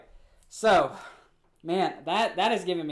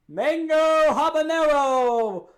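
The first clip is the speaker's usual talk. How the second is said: slow, big, drawn-out reveal; dramatic announcement; 6 semitones higher, 5 dB louder, much slower than usual. Acted. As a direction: announce it like a game show.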